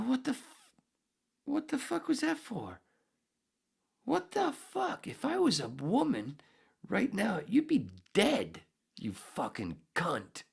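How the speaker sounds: very high, strained tirade, key words stretched; retold furious outburst. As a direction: act out outraged disbelief, slowing on the threat.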